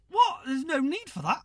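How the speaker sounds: high, loud shocked protest; outraged at the insult; retold indignant disbelief. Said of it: "I'm like".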